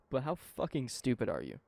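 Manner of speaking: ordinary talk, nothing marked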